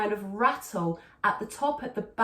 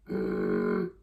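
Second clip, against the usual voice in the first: growl hum. Shown as a demonstration. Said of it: "So start there".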